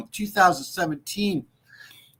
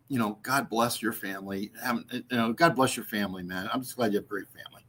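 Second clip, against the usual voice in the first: usual speaking voice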